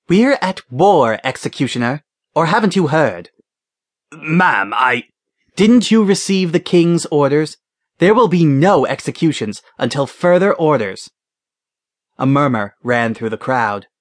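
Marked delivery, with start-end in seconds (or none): 0.1-11.1 stern woman commander. A narrator's reading. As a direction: dress him down with authority. Commander rebukes executioner.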